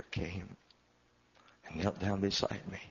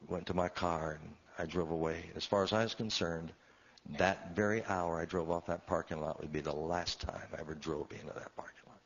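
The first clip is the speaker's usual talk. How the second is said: normal talking voice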